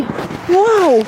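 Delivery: high startled whoa then laughing; surprised laugh. Real, not acted. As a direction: yelp then giggle. Sledding down a hill.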